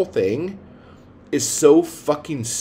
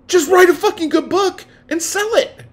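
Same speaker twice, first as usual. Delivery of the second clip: furious shout; 5 dB louder than usual. Real, not acted. Ranting at complaining male writers.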